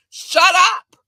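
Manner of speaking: high, loud "shut up"; shout. Real, not acted. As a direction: finally let it rip. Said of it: "been waiting to say that".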